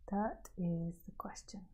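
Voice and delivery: hushed, unvoiced and soft; whisper. Real, not acted. Teacher whispers the point to the audience. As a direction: breathe it out like a secret.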